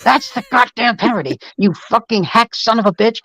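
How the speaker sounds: high, strained mock rant; exasperated retold tirade; imitated fury. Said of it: "He was so mad".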